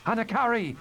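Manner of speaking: high call of a name. Acted. Frangine calling to Anakari mid-battle. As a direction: call across the fight. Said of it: "she called".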